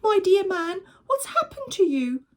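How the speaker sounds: high, loud wife crying out; frightened cry. Acted. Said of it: "cried Missus Gregg".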